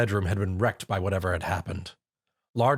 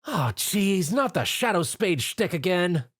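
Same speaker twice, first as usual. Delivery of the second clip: groaning; 8 semitones higher, slower than usual. A narrator's reading. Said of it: "she groaned".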